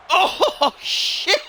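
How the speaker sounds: high laughing yell of surprise; excited shout. Real, not acted. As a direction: yelp it out in shock. Reacting to a big move.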